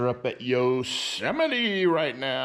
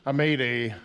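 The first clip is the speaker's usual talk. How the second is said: usual speaking voice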